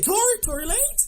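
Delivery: high, squeaky disbelief at the name; mock-confused, voice jumping up; baffled high squeak. Retelling his mixup to cohost, squeaking in disbelief. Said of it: "Listen, I said".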